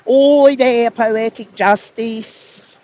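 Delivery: high, loud mock-Australian greeting; Australian accent. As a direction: sing out a hammed-up Aussie welcome, drawn out. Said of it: "Australian people say hello".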